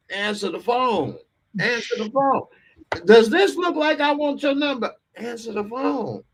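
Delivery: over-enunciate it, fed up; exasperated repeat. Retold or imitated. Retelling how he mouthed at the Wingstop worker, exasperated.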